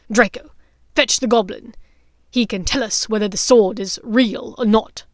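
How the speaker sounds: commanding Lucius. Acted. Lucius ordering Draco to fetch the goblin, commanding. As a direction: give the order crisply.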